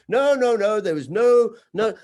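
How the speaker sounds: loud, high, hammed-up denial; shouting no over and over in mock panic. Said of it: "It's like".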